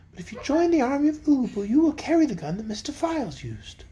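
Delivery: lightly voiced playful fairy. A narrator's reading. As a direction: tease him into agreeing. Polychrome coaxes Tick-Tock.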